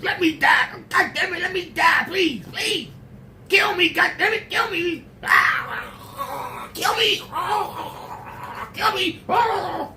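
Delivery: high, wailing cry to be killed; high shrieking plea of a suffering soul; tormented begging. Pretend. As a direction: scream at God for death. Cursing God, begging to die.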